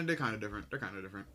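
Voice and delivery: muttering quietly. Real, not acted. Muttering to himself.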